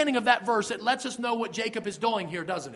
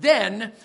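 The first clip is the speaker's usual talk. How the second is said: "then" pushed out louder with a rising tune; stress on then; 4 dB louder than usual. Shown as a demonstration. Pastor stressing "then" for his congregation, a rising emphasis. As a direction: lean hard on "then" and lift it. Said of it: "there's a reason I stressed the word".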